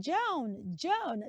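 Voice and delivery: "Joan" as one syllable with long o; appropriate pronunciation. Shown as a demonstration. Teacher correcting what a friend is called, appropriate "Joan". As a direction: say "Joan" to rhyme with bone. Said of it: "That's the appropriate pronunciation".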